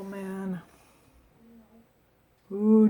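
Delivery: ordinary delivery, nothing marked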